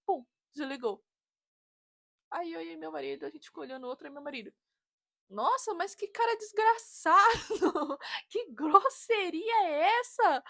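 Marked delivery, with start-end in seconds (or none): 5.3-10.4 mock outrage. Husband outraged after call.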